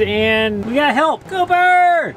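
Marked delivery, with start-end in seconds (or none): 1.3-2.1 loud call across the field. Real, not acted. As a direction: shout his name from a distance. Calling out to Cooper.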